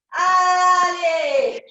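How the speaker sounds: loud rallying shout; coach shout. Real, not acted. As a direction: yell it to push the class. Coach urging the class.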